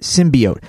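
"symbiote" said SIM-bee-oat; low "symbiote" with an EE in the middle; E pronunciation. Shown as a demonstration. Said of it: "the key thing here is the E".

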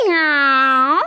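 loud mock meow; fake cat meow. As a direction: pretend to be the cat. Dog pretends to be cat.

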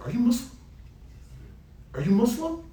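very high, rising imitation of disbelief; astonished question. Barber asking his customer about his faith, mock astonished. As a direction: imitate a barber taken aback, voice shooting up.

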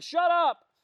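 high-pitched holler; mock shouted rebuke. Officer snapping at a passer-by who interrupts, shouting. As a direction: yell it to brush someone off. Acts rude reply to yeller.

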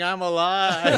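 loud, high, drawn-out mock yell; retold yell. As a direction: stretch out an imitated on-air holler.

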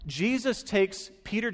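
ordinary talk

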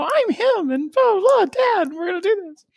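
put-on high, whiny boy voice; little whiny voice. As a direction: whine it like a young boy. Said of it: "talks in this little whiny voice like that".

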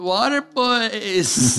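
high, very slow weasel drawl; Pauly Shore weasel voice. Retold or imitated. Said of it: "That's my weasel impression".

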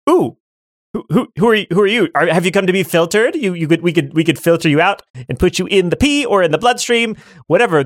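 high, curious little creature voice; startled kidney. Acted. Kidney greeting intruders in its cave, startled and curious.

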